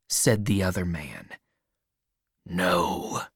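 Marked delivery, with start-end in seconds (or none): 2.4-3.3 dark, low refusal; Injun Joe. A narrator's reading. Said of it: "said Injun Joe darkly".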